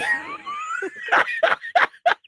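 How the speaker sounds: high, squealing burst of laughter; real laugh. Real, not acted. Laughing at his own vaccine theory, a high real laugh. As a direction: crack up at your own idea.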